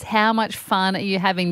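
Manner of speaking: ordinary talk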